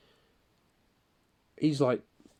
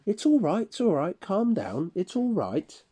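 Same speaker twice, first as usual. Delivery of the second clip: put-on higher, soothing voice of the girl; calming coworker; 10 semitones higher, 4 dB louder than usual. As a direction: settle him down, pitched up.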